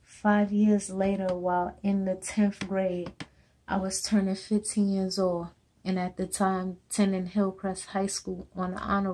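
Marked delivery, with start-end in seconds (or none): none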